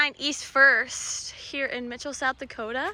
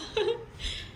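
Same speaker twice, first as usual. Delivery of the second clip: short laugh; 10 semitones higher, 8 dB quieter than usual. Real, not acted. Laugh after carpet joke.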